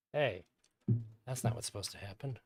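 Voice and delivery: ordinary talk, nothing marked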